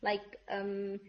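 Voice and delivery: ordinary talk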